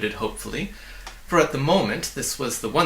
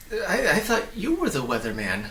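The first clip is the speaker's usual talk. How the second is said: confused; 5 semitones higher than usual. Acted. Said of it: "very confused".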